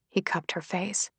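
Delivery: plain reading voice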